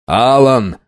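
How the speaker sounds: plain reading voice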